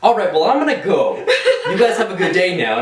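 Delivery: uneasy laugh while leaving the creepy men; nervous laughing exit. Retold or imitated. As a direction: back away with a nervous laugh. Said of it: "I was like".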